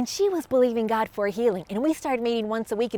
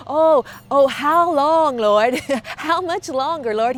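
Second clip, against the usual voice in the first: loud, drawn-out mock lament; crying out to God; 2 semitones higher, 4 dB louder, slower than usual. David crying out to God in the psalm, loud hammed-up plea. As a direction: call out to heaven, half in jest. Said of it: "he's crying out to God".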